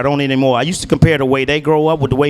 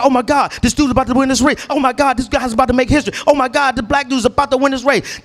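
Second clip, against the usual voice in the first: high, fast excited disbelief; can't believe it's happening; thrilled muttering at the TV; 6 semitones higher than usual. Retold or imitated. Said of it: "I got excited".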